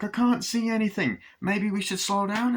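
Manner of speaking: high-pitched fretful mock voice; imitating worried Glenn. Glenn driving with Chucky, high worried voice.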